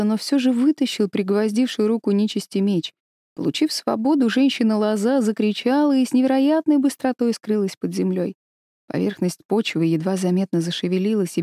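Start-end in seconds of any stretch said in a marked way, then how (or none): none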